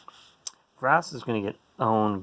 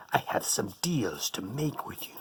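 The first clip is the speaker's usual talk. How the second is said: softer, unvoiced; sinister whisper; 4 semitones lower, 8 dB quieter than usual. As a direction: whisper the deal like a schemer. Whispered offer to underworld.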